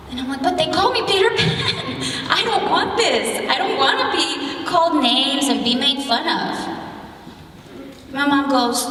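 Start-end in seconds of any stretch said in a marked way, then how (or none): 0.4-6.5 put-on high, pleading child's complaint; acted whine with a laugh in it, starting high and easing down; whiny protest. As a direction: imitate an upset kid whining to mom. Child protesting to mom, retold.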